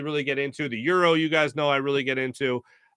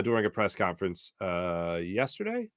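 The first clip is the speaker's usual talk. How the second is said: usual speaking voice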